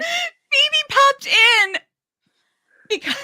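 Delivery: high, slow, laughing through the words. Real, not acted. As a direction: giggle it out. Laughing at posting mistake.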